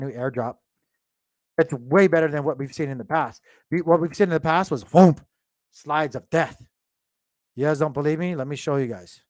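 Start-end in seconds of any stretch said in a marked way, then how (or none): 4.8-5.2 a loud, sudden mock whoomp for a crash; whoomp crash sound. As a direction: make the noise of a price plunging. Imitating a sudden price crash.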